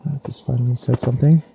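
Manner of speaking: ordinary talk, nothing marked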